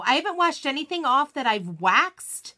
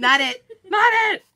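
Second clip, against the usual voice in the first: rising, louder playful call; calling dibs, getting louder and higher; playful not it; 9 semitones higher, 9 dB louder than usual. Real, not acted. Host teasing friend, calling out gleefully.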